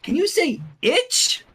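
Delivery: high, loud sing-song coaxing; exaggerated baby-talk coaxing; coaxing a toddler. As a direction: sweet-talk the baby into a new word.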